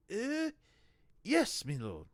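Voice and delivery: high nervous yelp of assent; a frightened underling agreeing fast; nervous reply. A narrator's reading. Inquisitor answers Vorbis with a nervous high yelp. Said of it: "gave him a nervous smile".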